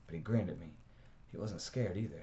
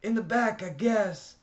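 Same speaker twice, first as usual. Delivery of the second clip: much higher, much louder holler; yelling back over the flames; 10 dB louder, slower than usual. A narrator's reading. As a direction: holler the answer over the fire. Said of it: "I hollered".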